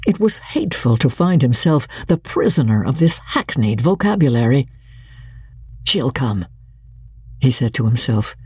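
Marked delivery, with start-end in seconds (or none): 5.8-6.5 quiet, half-scornful certainty; almost contemptuous. A narrator's reading. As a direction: dismiss her with a soft sneer. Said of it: "almost contemptuously".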